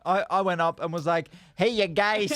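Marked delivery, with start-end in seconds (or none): 1.5-2.4 higher, hammed-up American showman; thick American accent. Comedian approaching the fans, thick American accent. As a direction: greet them in broad New York American. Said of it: "we just did our thick best American accents".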